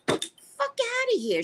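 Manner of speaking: very high, drawn-out dismissal; hammed-up incredulous scoff; retold scornful disbelief. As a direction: wave it off in high, slow mock outrage. Retelling her scornful reaction.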